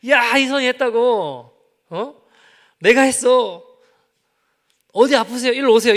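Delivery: loud, high bragging shout; boastful pastor. Pretend. Preacher mocks an arrogant pastor bragging to his friend, shouting. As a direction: crow that you did it yourself.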